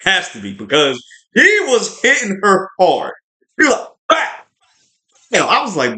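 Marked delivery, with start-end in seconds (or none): 4.1-4.4 a short barked "Bah!" as he swings; mock battle grunt. Hammed-up attack grunt as Axe hits Bo. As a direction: huffs it out like a fighter striking. Villain attacks in retold fight.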